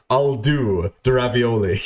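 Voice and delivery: slow, drawn-out mock order; imitated diner's order. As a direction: stretch the order out deliberately. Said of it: "Aiden fucking says".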